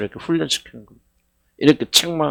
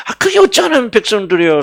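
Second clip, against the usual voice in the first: pitched up and louder, scolding the crowd; a sharp, raised rebuke; indignant rhetorical outburst; 9 semitones higher, 4 dB louder than usual. Acted. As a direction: fling the question at them in disbelief. Preacher repeats his rhetorical question louder.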